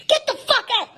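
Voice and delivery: high taunting shout; high jeer. Retold or imitated. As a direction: chase him out, high. Crowd jeering.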